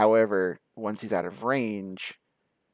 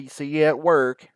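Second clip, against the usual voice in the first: louder, over-articulated repeat; exaggerated mouthing, repeated; 3 semitones higher, 6 dB louder than usual. Shown as a demonstration. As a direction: say it again for a lip reader, mouthing it clearly. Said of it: "really have to exaggerate it on my lips".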